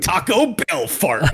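slow, high mock voice; imitating co-host. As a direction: drag it out high, mimicking your co-host. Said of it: "And then James is like".

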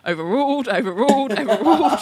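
rising, laughing repeated overruled; mock judge. Mocking the judge dismissing every objection. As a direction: bang out overruled like an impatient judge. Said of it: "And Judge Walsh is like".

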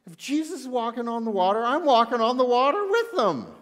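high, loud and fired-up; acted-out bold Peter, rising to a near shout; eager insistence. Peter refusing to stay in the boat, eagerly insistent.

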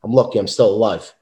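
ordinary talk

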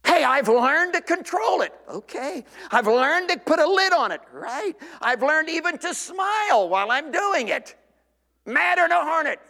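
defiant self-defense. Acted. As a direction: bristle like a man defending his temper. Acted defensive churchgoer justifying temper.

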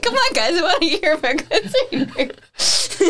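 high, laughing, pleading voice; laughing plea. Retold or imitated. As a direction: beg through laughter. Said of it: "That's why I was like".

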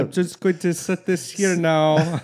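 high, slow put-on comic voice; hammed-up movie impression; Will Ferrell. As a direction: stretch it out in a high mock character voice. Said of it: "Will Ferrell's like".

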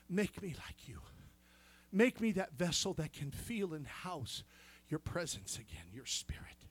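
soft prayer that sinks into a whisper; hushed prayer fading to whisper. Acted. Preacher modelling a prayer to God, fading to a whisper. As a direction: pray it softly, ending in a breath.

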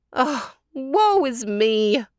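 slow, high storybook wail; theatrical moan of woe. A narrator's reading. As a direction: lament it with long drawn-out words. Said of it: "wailed Tante Adela".

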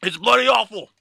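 loud, high outburst of a disgusted British chef; Gordon Ramsay. Acted. Mock chef passing verdict on foreign chocolate, loud outrage. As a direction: yell it like an appalled celebrity chef. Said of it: "Gordon Ramsay".

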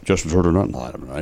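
low mumbled announcer; Ross Porter. Acted. Testing a player's name in the drunk announcer bit, mumbled impression. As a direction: slide down low through the catchphrase. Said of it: "a Ross Porter impression".

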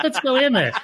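imitating the eager wife, laughing; mock wife. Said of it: "she's like".

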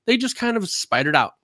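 ordinary talk, nothing marked

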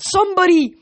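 high, loud cry for anyone; desperate yell. Acted. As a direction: call out in panic. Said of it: "Piper yelled".

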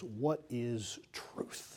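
slow, soft and pondering, the words stretched out; a hushed, drawn-out question; slow musing. Acted. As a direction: let Pilate's question hang, slow and quiet. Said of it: "said to him those famous words".

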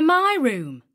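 high, emphatic "my"; stress on "my". A narrator's reading. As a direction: punch the word "my" indignantly. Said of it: "emphasizing the word".

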